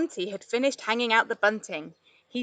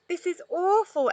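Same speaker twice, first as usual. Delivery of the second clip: groaning; 9 semitones higher than usual. A narrator's reading. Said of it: "Esther groaned".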